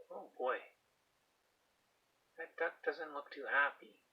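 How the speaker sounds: usual speaking voice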